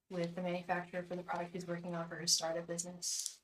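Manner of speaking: soft, repetitive chant-like prayer; quiet invocation. Acted. Asking spirit guides about the meeting, a soft repeated invocation. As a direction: murmur the call to the spirit guides over and over.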